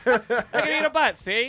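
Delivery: high, loud 1930s gangster, see; a wiseguy tough talking, see; old-timey voice. Pretend. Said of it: "The old-timey voice".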